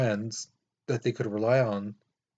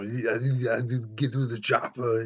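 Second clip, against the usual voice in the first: lightly voiced Austrian action-star accent; a hint of a thick Austrian accent, muscle-man swagger; Arnold Schwarzenegger impression. Teacher imitating Schwarzenegger for the class, mild Austrian accent. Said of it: "you know, that guy".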